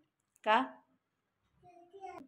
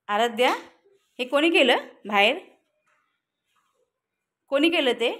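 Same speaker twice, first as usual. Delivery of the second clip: scolding call; 3 semitones higher, 6 dB louder than usual. Real, not acted. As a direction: demand who did it, loudly. Mother scolds kids over balcony mess.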